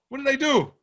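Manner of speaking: usual speaking voice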